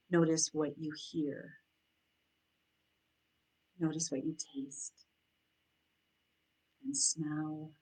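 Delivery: soft, unhurried meditation voice with long pauses; guided meditation. Acted. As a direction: guide them gently into noticing their breath and senses.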